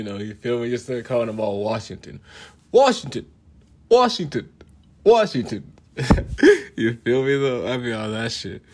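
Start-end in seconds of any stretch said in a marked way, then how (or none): 2.7-5.7 high, loud repeated calls; calling out. Pretend. Calling his slaves all Washington, loud calling out. 6.0-6.7 loud, high cackle; high burst of laughter. Real, not acted. Cracking up at his own joke, high laugh.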